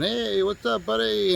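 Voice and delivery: a high, casual imitation of Mister Singh strolling in; breezy greeting impression. Partner strolls into the open house, high breezy hello.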